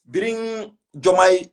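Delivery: normal talking voice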